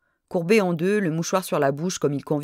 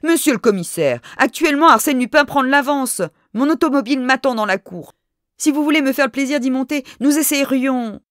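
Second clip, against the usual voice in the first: loud, urgent exclaim; much louder and higher eager offer; 8 semitones higher, 6 dB louder than usual. A narrator's reading. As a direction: call out urgently to the commissioner. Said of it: "je m'écriai".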